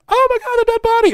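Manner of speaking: very high, loud shriek of horror; hammed-up scream at finding a corpse; mock scream.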